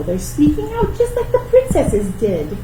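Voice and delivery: ordinary talk, nothing marked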